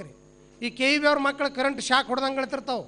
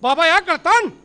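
loud street-show question; snake charmer; 2 semitones higher, 7 dB louder than usual. Acted. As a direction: call it out like a showman's patter. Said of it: "ನೋಡಿದ ತಕ್ಷಣ ಇವಂಗ್ ನೆನಪಾಗಿಬಿಡ್ತು".

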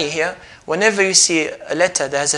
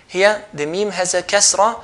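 ordinary talk

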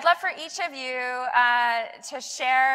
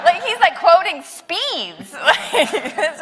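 laughing out loud in amazement, a little higher; 4 semitones higher, 5 dB louder, slower than usual. Real, not acted. Host laughing at the astronaut quoting exact speeds. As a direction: giggle through the words in awe.

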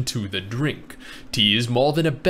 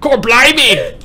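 loud, high British outburst; mock British exclamation; 8 semitones higher, 9 dB louder, slower than usual. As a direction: shout it out as an exaggerated Brit.

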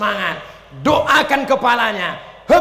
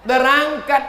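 ordinary delivery

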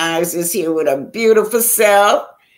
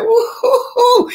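high stretched woo-hoo-hoo whoop; slower than usual. Retold or imitated. Cheering the older woman who threw her, gleeful whoop.

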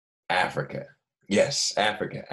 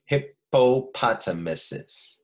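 very slow, syllable by syllable; 4 semitones higher, much slower than usual. Shown as a demonstration. Teacher to kids, modeling Hippopotamuses very slowly. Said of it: "so we're gonna say it again".

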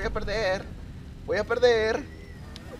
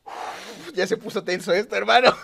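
ordinary talk

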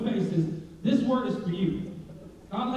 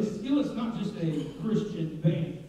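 ordinary talk

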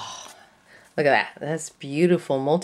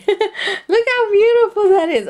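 high delighted squeal; gushing; 5 dB louder than usual. Real, not acted. Crafter admiring her supplies, high squeal. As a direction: squeal at how pretty it is.

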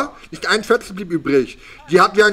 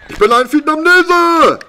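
much higher, loud victory cry; triumphant shout; 11 semitones higher, 6 dB louder than usual. Real, not acted. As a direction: yell it out in triumph. Triumph after eating the worm.

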